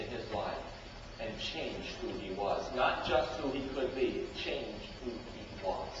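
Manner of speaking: low, soft weighty close; preacher's sober conclusion; solemn. Real, not acted. Solemn closing.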